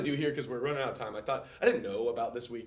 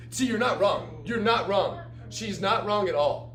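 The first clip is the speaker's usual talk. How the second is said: excited agreement; 9 semitones higher, 7 dB louder, slower than usual. Real, not acted. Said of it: "responding to student answer".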